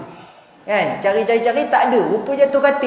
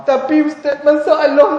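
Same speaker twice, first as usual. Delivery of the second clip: higher, louder mock whine of a husband; whining husband; 6 semitones higher, 5 dB louder than usual. To the ustaz, the husband whines about his problem. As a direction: plead like a man close to tears. Crying soldier husband voiced.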